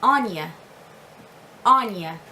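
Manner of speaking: Anya as "AWN-ya", falling; long open first vowel in Anya; Irish name demo. Shown as a demonstration. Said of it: "and it is Anya".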